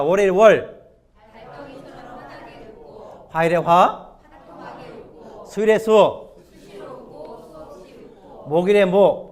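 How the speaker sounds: projected cue to the congregation; calling out headings. Real, not acted. Leading congregation through day headings. (힘차게 선창하듯)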